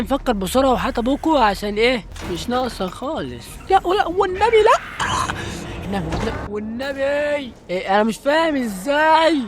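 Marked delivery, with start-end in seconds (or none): 3.7-7.5 high-pitched desperate pleading, real alarm; panicked pleading. Real, not acted. Player begging the horror game to spare him, panicked pleading. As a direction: beg in a high, panicky voice, drawing out the end.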